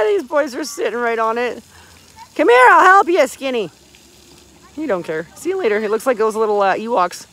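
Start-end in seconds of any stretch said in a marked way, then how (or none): 2.3-3.7 calling out. Real, not acted. Mom calling to a child to help him, loud call. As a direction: shout an offer of help, high.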